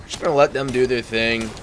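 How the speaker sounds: normal talking voice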